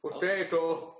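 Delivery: mock Irish accent. Co-host jokes after refusing the accent, mock Irish. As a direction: toss out a silly Irish word through a laugh.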